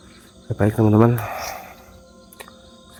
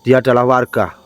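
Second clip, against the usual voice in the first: loud, higher scolding of the spirit; angry confrontation; 4 semitones higher, 6 dB louder than usual. Real, not acted. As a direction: drive it off for harming the villager. Scolding the spirit that hurt someone. (memarahi jin dengan suara keras)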